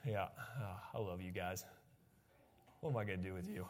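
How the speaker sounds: soft fond sigh at the crowd; low, soft, fondly fed up; loving exasperation. Real, not acted. Teasing sleepy congregation.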